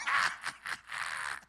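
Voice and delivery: mock witch's cackle. Preacher mocking the witch on a broom, cackling. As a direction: cackle in separate bursts like a storybook witch.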